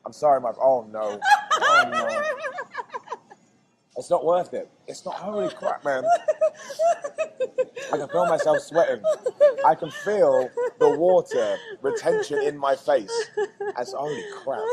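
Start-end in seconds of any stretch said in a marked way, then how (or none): 0.0-2.2 laughing in pain. Real, not acted. Chili burning mouth. 4.9-14.6 sweating, laughing through the burn; pushed out through a burning mouth; overheated from spice. Real, not acted. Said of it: "You're so red".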